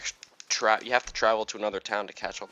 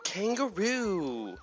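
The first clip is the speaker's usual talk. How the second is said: high, drawn-out delighted exclamation; excited greeting; 10 semitones higher, much slower than usual. Real, not acted. Player greeting the new kangaroo villager, excited and drawn out. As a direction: greet the new kangaroo like a long-lost friend.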